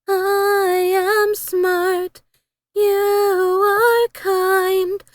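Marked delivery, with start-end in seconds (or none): none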